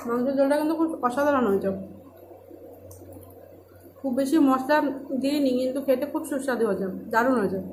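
chewing between words; talking during a meal; mouth partly full. Real, not acted. Seen 0: eating meal by hand; chews between phrases, hand to mouth at 1.6.

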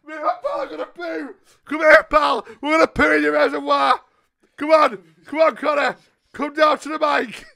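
high, loud hammed-up calling; mock wailing shout. Caller shouting for his friend to join the prank. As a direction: yell for your pal in mock despair.

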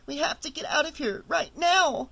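usual speaking voice

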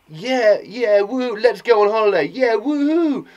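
high, loud whooping; gleeful hammed-up celebration; acted holiday cheer. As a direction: cheer like an excited cartoon character. Bananas cheer going on holiday.

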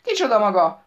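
loud demanding shout; yelling woman. Acted. The daughter yells at the stranger on the phone, loud. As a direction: bark the question down the phone. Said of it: "ordított a nő a telefonba".

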